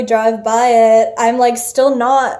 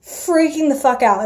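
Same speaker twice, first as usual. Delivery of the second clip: ordinary delivery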